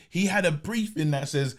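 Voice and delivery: ordinary talk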